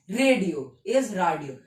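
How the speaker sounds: Radio slowly, German "ray" against English radio; slow contrast. Shown as a demonstration. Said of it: "बस pronunciation में difference है".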